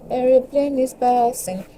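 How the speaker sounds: high, slow "Aeroplane is passing" again in singsong; singsong repeat. Pretend. Teasing the passing plane, slow high singsong. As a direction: drawl it out playfully.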